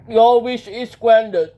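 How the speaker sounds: slow, loud genie pronouncement. Acted. Genie granting a wish, slow loud proclamation. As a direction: proclaim it slowly and loudly.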